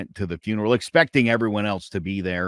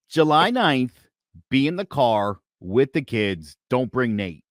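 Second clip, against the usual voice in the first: normal talking voice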